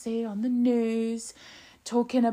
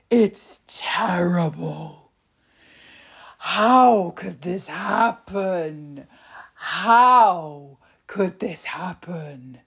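slow, hushed, scandalised gasping whisper; mock outraged whisper; slower than usual. Pundits melting down over Starmer flying Ryanair, hammed-up horrified whisper. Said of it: "They were like".

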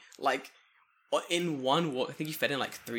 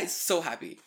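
ordinary talk

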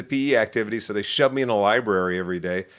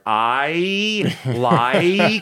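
stiff, louder robotic "I like to"; 5 semitones higher, 5 dB louder, much slower than usual. Retold or imitated. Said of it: "sounded like a robot".

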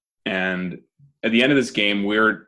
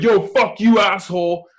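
high, loud mock yell; parents yelling; 11 semitones higher, 5 dB louder than usual. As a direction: shout it across the field. Parents fighting at football game.

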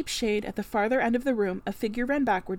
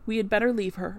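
usual speaking voice

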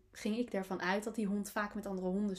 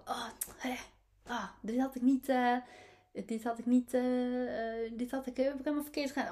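acted fretting over a mistake, higher and stammering; frustrated with herself; 4 semitones higher than usual. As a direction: stumble through exasperated self-reproach. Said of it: "had ik daar dan dus best van gebaald".

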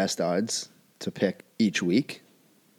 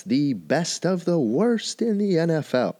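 high, slow sarcastic jab; mocking; 9 semitones higher, slower than usual. Real, not acted. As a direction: sneer at the best of the worst, slow and high. Mocking weak NFC teams.

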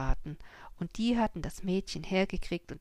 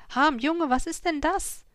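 high, loud startled cry; alarmed shout; 9 semitones higher, 7 dB louder than usual. A narrator's reading. Farmer shouting to his son Harm, high and alarmed. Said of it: "schrie er auf einmal".

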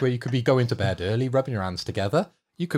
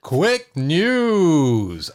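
announcer; 4 semitones higher, 6 dB louder than usual. Acted. Announces a news segment.

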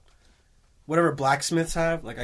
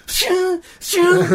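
stabbing sound effect; 7 dB louder than usual. Retold or imitated. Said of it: "So he's just, like".

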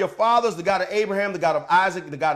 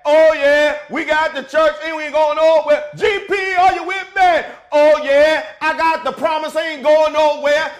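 shouted call and response, much higher and louder; 8 semitones higher, 9 dB louder than usual. Pretend. Pastor rallying the congregation, shouted call and response. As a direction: fire up the church like a gospel choir leader. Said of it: "let me get my inner [name] on".

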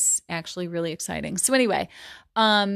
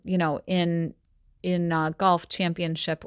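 usual speaking voice

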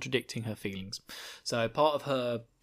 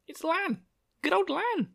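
very high, gleeful cheer; hammed-up falsetto delight; mock-excited announcement. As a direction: hail an old favourite in a squeaky sing-out. Announces a character with fanfare.

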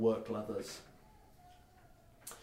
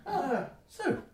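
high, raised gnome voice; gnome's greeting; 11 semitones higher, 4 dB louder than usual. Acted. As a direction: greet the whole group up high and louder. Gnome greets the whole party.

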